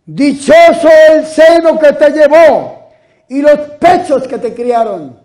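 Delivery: loud, high, slow cry from the crowd; woman in the crowd shouting praise; woman's shout. Acted. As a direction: call out to Jesus over the people. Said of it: "alzó la voz una mujer".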